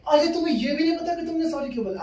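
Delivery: high-pitched mimicked indignation; outraged girlfriend. Girlfriend outraged he can't explain his apology, high indignant mimicry. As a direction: shoot the pitch up in mock outrage.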